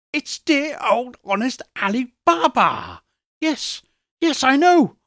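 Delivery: put-on excited old Scrooge. A narrator's reading. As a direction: Scrooge reliving boyhood stories with glee.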